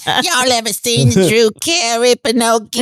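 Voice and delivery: high, slow voice, chewing gum; gum-chewing stripper voice. Pretend. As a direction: chew gum while you drawl it out high. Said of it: "She's chewing gum".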